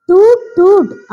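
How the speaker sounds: high, loud toot toot like a car horn; playful mock car horn. Shown as a demonstration. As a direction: honk it out high and bright. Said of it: "എന്ന് horn അടിച്ചുകൊണ്ട് പറയണേ".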